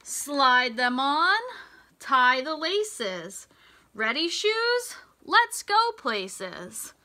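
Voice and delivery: bright, sing-song storybook read; lilting picture-book voice; reading to children. Acted. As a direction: read the rhyme to a class of little kids. Reads rhyming picture book aloud.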